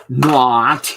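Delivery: loud, punchy single-word retort; a loud flat-out refusal; emphatic not. Real, not acted. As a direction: slam the door on the idea. Emphatic retort to quoted claim.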